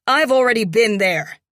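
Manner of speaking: fast, loud comeback; snappy retort. A narrator's reading. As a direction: fire it straight back. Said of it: "I shoot back".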